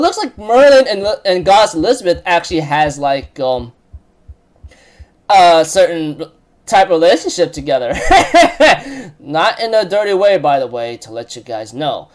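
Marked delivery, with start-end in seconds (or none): none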